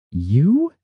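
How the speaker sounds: high, rising one-word question. A narrator's reading. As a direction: ask it with the pitch sweeping sharply upward. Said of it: "Soria asked".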